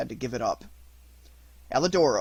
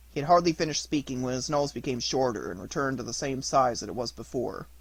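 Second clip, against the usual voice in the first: ordinary delivery, nothing marked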